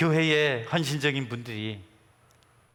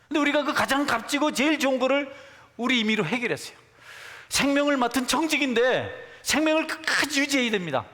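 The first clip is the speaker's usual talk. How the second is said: high, louder pulpit voice building conviction; fervent preaching; 8 semitones higher, 3 dB louder than usual. Acted. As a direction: preach it out with full force. Preacher at full force on life. (높은 목소리로 힘차게 설교)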